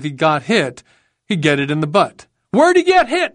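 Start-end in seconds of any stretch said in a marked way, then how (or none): 2.5-3.3 very high, loud shouted question. A narrator's reading. Marine asking where his buddy was wounded, shouting. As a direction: shout the question across a battlefield. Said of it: "I shouted".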